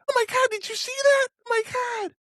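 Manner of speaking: very high falsetto squeal; hammed-up shrieky "oh my God" voice; squealing impression. Podcaster imitating an amazed onlooker at the javelin throw, squealing falsetto. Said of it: "You do that very well".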